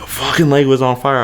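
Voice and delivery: normal talking voice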